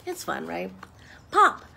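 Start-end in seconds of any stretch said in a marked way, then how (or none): 1.3-1.6 high, loud sound effect; excited storyteller pop. Acted. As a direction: burst it out like a pop. Button pops in story.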